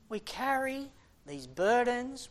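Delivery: ordinary talk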